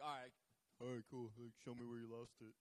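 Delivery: low, casual, laid-back voice; unruffled reply. Acted. Elisha answering the man who lost the axe, low and casual. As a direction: take the news coolly, pitched well down.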